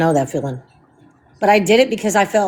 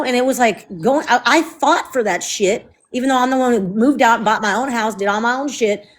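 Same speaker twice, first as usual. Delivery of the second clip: ordinary talk, nothing marked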